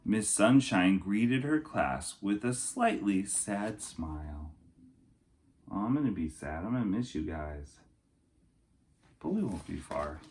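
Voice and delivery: storybook narration for kindergartners; lilting rhyming picture-book voice; reading to children. Acted. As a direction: read it like story time for little kids. Reads picture book aloud.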